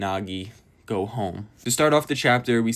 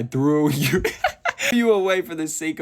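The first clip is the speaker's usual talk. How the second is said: real laugh breaking through, pitched up; 7 semitones higher, 4 dB louder than usual. Real, not acted. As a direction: crack up halfway through reading the line. Cracks up while reading quote.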